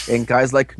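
ordinary delivery

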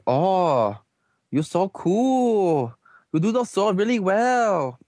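high, drawn-out fawning onlookers; mock admiration. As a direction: gush with exaggerated, slow awe. Voicing admiring onlookers in recap.

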